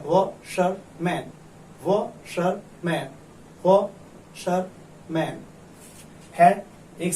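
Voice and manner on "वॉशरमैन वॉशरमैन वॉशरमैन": Washerman slowly in three parts, Waugh-sir-man; syllable by syllable. Shown as a demonstration. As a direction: break washerman into three separated beats. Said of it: "तीन syllable का है". (Washerman को धीरे, तीन टुकड़ों में तोड़कर)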